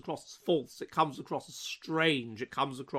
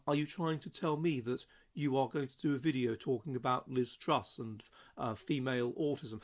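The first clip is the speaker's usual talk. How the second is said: softer, lower, pedantic questioning voice; friend's odd voice; 4 semitones lower, 8 dB quieter than usual. Retold or imitated. University friend grilling him about the video, in an odd, soft, pedantic voice. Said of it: "the way he spoke was in this very unusual way".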